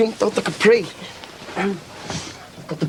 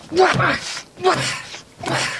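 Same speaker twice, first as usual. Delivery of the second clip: imitated whacks; 14 semitones higher, much slower than usual. Acting out blows in story.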